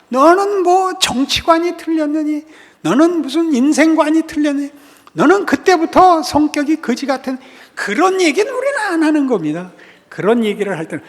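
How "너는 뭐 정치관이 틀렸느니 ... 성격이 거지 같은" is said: high, loud acted scolding; mock fault-finding. Preacher imitating someone criticising a friend, mock scolding. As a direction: pile up accusations at a friend.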